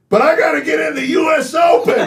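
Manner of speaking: put-on high, loud whiny child's cry; frustrated kid yell. Young son protesting to dad on driving range, high frustrated yell. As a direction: wail it like a frustrated seven-year-old. Seven-year-old son's frustrated outburst.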